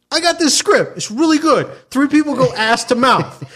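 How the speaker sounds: high, gung-ho director pitch; Tom Six. Acted. Director pitching Human Centipede to actors, high eager pitch. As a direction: sell the outrageous idea with total confidence. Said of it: "now imagine Tom Six, that's the director".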